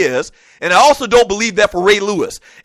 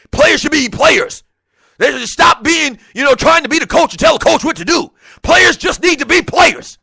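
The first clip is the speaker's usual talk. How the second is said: loud, higher ranting coach; lightly done fired-up coach rant; Herm Edwards impersonation; 6 semitones higher, 3 dB louder than usual. Retold or imitated. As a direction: rant like an angry ex-coach about players. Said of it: "That's my best Herm Edwards impersonation".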